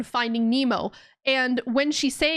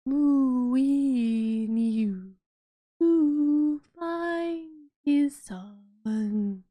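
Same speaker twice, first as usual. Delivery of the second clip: Dory speaking whale; 3 semitones higher, much slower than usual. Acted. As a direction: drag each syllable out like a whale. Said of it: "what Dory says when she speaks whale".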